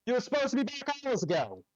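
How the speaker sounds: scolding guard. Acted. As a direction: tell them off like an impatient guard.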